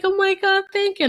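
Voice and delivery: high-pitched gushing thanks; imitating happy, weepy thanks; mock tearful gratitude. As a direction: squeak it out as if welling up. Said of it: "Now I'm crying because".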